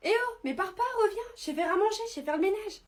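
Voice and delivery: high, loud mock plea; desperate calling out. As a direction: call after someone walking off, begging loudly. Begging a stranger not to leave.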